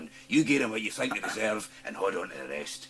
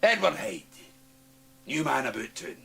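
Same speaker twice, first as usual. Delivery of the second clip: loud proud declaration; 8 semitones higher, 8 dB louder than usual. Acted.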